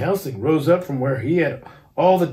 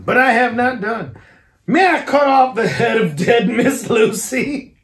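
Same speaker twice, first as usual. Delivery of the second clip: professor building up; 6 semitones higher, 4 dB louder than usual. A narrator's reading. Van Helsing builds to request.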